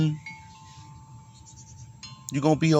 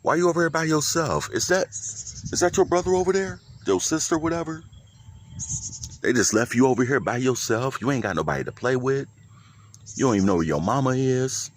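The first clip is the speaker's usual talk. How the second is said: pet talk; 4 semitones higher than usual. Real, not acted. Talking to a lonely kitten.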